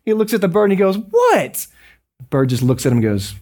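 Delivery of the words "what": very high, irritated yelp; exasperated customer. Retold or imitated. Customer to the stinking bird calling him again, exasperated. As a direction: squeak it out in comic exasperation.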